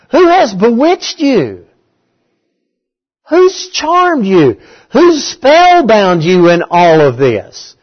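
high, drawn-out challenging question; exasperated rebuke. Retold or imitated. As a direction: demand it slowly, voicing an indignant apostle. Preacher voices Paul's rebuke of Galatians.

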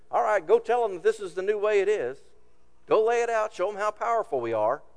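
loud, high commanding king; bossy order. Acted. Rehoboam orders Adoram to lay down the law, loud command. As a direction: bark the order with pride in power.

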